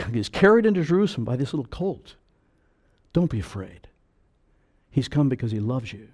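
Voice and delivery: normal talking voice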